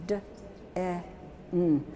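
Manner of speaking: "D", "E", "N" as bare sounds, not letter names; separate sounds. Shown as a demonstration. Teacher letting out den, "D, E, N" as separate sounds. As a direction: sound out "D, E, N" with gaps between. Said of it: "Let it out".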